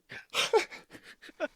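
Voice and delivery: loud high laugh; burst of laughter. Real, not acted. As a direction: laugh out hard. Laughs after own joke.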